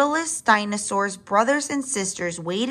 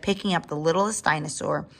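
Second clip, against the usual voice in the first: ordinary delivery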